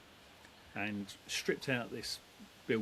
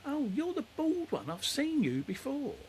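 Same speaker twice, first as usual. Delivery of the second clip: put-on high, slow little old lady; 5 dB louder, slower than usual. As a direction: play the sweet old neighbour, up high. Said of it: "and she said".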